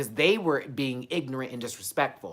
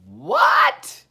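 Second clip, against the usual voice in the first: high, loud shriek of disbelief; squealed, incredulous "what?"; shocked what; 11 dB louder than usual. Real, not acted. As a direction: burst out in disbelief. Shocked by news of the apology.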